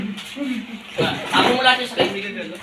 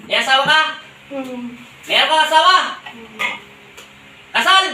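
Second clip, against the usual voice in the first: loud interrogation; 3 semitones higher, 6 dB louder than usual. Real, not acted. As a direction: grill the spirit louder and louder. Healer interrogates spirit. (malakas na pagtatanong)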